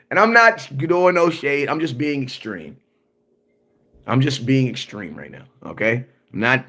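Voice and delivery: ordinary delivery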